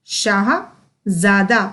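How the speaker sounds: शाहजादा split as शाह, ज़ादा with a pause; slow, in parts. Shown as a demonstration. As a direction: sound out Shahzada slowly, z in the second part. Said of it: "उच्चारण पर बहुत ही अधिक ध्यान". (शाह-ज़ादा को तोड़कर धीरे बोलना)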